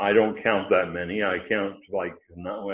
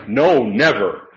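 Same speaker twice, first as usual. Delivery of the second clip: "no, never" louder, slow and forceful; emphatic never; 3 semitones higher, 7 dB louder, slower than usual. Shown as a demonstration. A teacher showing the class a Greek emphatic negative, loud and slow. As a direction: lean hard and slow on "never". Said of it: "It's emphatic".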